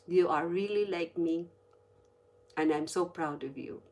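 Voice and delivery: low, soft, unhurried quote; tender retold words. Dad to daughter before he passed away, tender. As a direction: speak gently with pauses between phrases.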